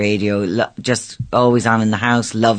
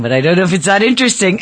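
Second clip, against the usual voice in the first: laughing it off, higher and quick; breaking into a shy laugh; 7 semitones higher, faster than usual. Real, not acted. Modest laugh at own story.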